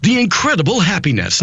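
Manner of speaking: high, beaming voiceover parody; over-the-top Disney announcer; happy announcer. Pretend. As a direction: sell incredible happiness like a cheesy promo. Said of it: "the most American famous voiceover artist for incredible happiness".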